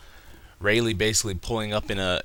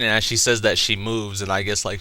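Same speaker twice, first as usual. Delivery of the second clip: normal talking voice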